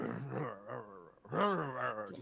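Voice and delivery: wordless huffy mumble; mumbled grumbling. Acted. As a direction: grumble nonsense under a huff. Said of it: "kind of mumbling nonsense".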